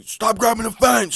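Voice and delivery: mock referee yell at the fence; referee shout. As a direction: bark it like a ref breaking them up. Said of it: "from Chris Tognoni".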